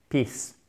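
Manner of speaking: "peace" as "piss", short lax i, softly. Shown as a demonstration. Teacher joking about the mispronunciation, short i. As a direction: let peace out short and quiet. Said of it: "Ça, c'est la peace, littéralement".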